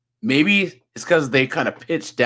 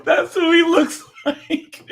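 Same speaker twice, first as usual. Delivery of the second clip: high, squeezed-out words through laughter; laughing too hard to talk normally; real laughing; 3 dB louder, slower than usual. Real, not acted. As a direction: say it slowly while cracking up. Laughing at own comparison.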